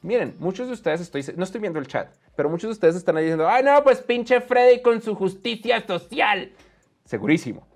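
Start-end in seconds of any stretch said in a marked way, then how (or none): 3.5-6.5 slow, nagging mock complaint; sneering mock voice of an online critic; mocking whiny critic. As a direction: drag it out like a whiny troll. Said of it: "no estoy imitando ningún acento".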